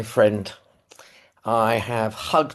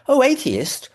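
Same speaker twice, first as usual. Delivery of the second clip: mock startled; 13 semitones higher, 4 dB louder than usual. Mishearing anaesthetist, nervous retelling.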